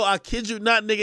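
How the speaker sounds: ordinary talk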